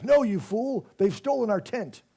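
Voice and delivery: high, loud hammed-up English accent as Sherlock; loud mock-British Sherlock; pretend English accent. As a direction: bark it out like an indignant Englishman. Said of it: "my pretend English accent".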